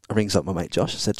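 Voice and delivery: usual speaking voice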